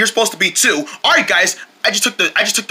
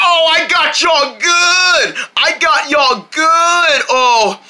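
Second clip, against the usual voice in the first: gotcha gloat; 7 semitones higher, much slower than usual. Pretend. Reveals the crying was fake.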